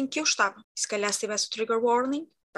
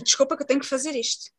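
ordinary talk, nothing marked

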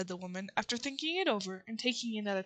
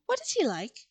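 put-on girl's voice; 10 semitones higher, 4 dB louder than usual. A narrator's reading. Dorothy asks about Oz.

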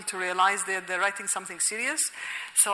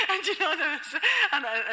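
laughing; 7 semitones higher, 3 dB louder than usual. Real, not acted. Amused at Disney beast dubbing.

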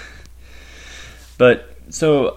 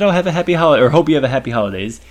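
louder, lifted retold send-off; cheery farewell; 5 semitones higher, 8 dB louder, faster than usual. Store worker to departing guest, cheery raised voice. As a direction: call out a friendly holiday wish.

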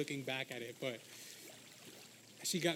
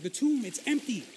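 excited; 7 semitones higher, 6 dB louder than usual. Retold or imitated. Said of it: "she's like".

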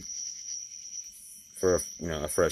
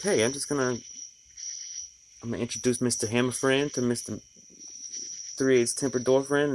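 higher, playful imitation of a careless coworker; goofy mock voice; 5 semitones higher than usual. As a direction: lightly mimic a clueless buddy with a hammer. Mocking coworker's careless thought.

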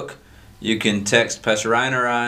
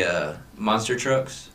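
normal talking voice

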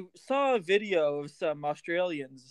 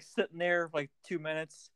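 ordinary talk

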